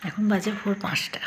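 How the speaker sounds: soft dawn whisper. Real, not acted. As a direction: breathe it out quietly. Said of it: "আস্তে আস্তে কথা বলছি".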